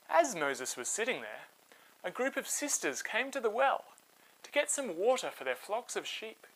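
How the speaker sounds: put-on lively read-aloud voice for kids; storybook narration. A narrator's reading. Host telling children a Bible story, animated narration.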